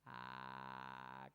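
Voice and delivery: low croaky drone like a didgeridoo; croaky morning voice. Shown as a demonstration. Performer shows the audience the didgeridoo sound, croaky and low. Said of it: "croaky voice".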